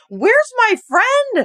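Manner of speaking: high, slow, drawn-out calling for a missing friend; mock plaintive call. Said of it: "I'm like".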